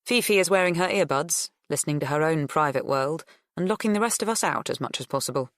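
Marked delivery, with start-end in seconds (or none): none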